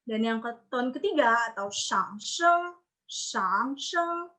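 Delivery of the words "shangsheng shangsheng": curve "shang" down then keep "sheng" up; third tone. Shown as a demonstration. Teacher naming the third tone, dipping tone. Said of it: "itu melengkung".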